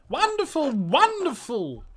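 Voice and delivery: high, loud, theatrical greeting; booming showman voice; flamboyant eccentric. Acted. Flamboyant NPC.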